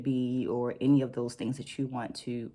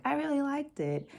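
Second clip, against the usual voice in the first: mock gushing feedback; 11 semitones higher than usual. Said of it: "rather than saying, like".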